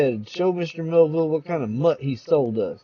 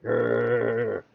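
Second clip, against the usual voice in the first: dog growl; 5 semitones lower, 4 dB quieter than usual. Acted.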